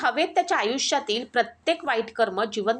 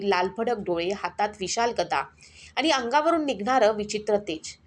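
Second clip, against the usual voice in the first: ordinary delivery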